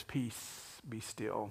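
soft, whispered "Peace, be still"; hushed command. Retold or imitated. Jesus quietly calming the storm, whispered. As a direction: hush the storm with a quiet word.

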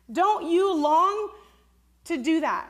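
slow, loud, high plea; preaching appeal. Acted. Pastor urging her congregation to seek the Spirit. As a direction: draw the listeners in with longing.

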